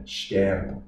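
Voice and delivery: sterben beginning with sh, not s; sh sound. Shown as a demonstration. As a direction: start sterben with a soft sh. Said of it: "is sh".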